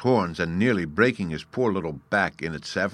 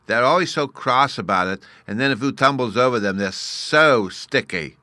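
loud, raised, dragging out the end; little fairy boy, disgusted; 4 semitones higher, 6 dB louder than usual. Acted. Bruno shudders at shell-less snails, disgusted little fairy. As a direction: complain in a small boy's voice, slowing on the last words.

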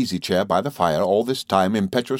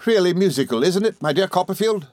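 high, enchanted gentleman; fondly thrilled, pitched well up; excitable friend; 10 semitones higher than usual. A narrator's reading. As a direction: invite a friend to share the delight.

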